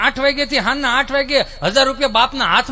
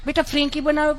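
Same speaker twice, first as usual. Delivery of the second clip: higher, sweet mother coaxing her son; doting mother; 6 semitones higher, faster than usual. Retold or imitated. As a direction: coo at the little boy like a fond mom. (લાડ કરતી mummy)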